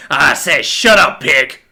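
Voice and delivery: loud angry order. A narrator's reading. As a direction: shut him up forcefully. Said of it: "in a louder, angrier voice".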